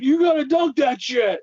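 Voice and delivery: high-pitched impression of a hot-headed movie character; put-on, pushy movie-character voice; Anger Management guy. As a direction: bark it out high, mimicking a movie rant. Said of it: "like the guy from Anger Management".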